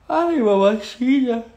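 muy agudo, lento y más fuerte, arrullando; háblale como a un bebé consentido; voz de mimo a la mascota. Real, not acted. Hablándole cariñosa a la perrita. (a la perrita, voz de mimo aguda y lenta)

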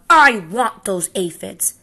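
loud, slow, greedy grumble; grouchy demand. Acted. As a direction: insist like a spoiled bully.

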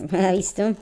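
ordinary delivery

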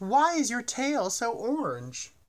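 high, slow astonished question. A narrator's reading. Bird asks the lion about his orange tail, slow and astonished. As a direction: ask it wide-eyed, drawing it out.

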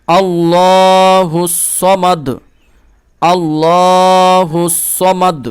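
slow chanted আল্লাহুস Samad, stopping on Samad; teacher models the verse; tajweed recitation. Shown as a demonstration. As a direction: recite slowly with a full voice. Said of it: "আয়াতের জন্য এখানে থামবো".

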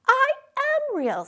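high, pleading insistence; insisting he is real. A narrator's reading. Little rabbit protesting to the wild rabbits, high and pleading. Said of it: "said the little rabbit".

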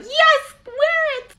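very high chirpy yes; mom agreeing. Retold or imitated. Mom letting the kid wear the dress, chirpy high. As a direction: sing out an easy yes. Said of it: "she was probably like".

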